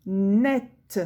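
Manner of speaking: "net" with a held n and open "è"; slow model reading of "net"; syllable sounded out. Shown as a demonstration. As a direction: stretch "net", ending on a sounded t. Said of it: "ça va faire le son est".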